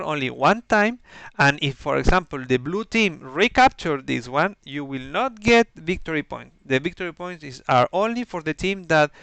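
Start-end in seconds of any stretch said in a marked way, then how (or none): none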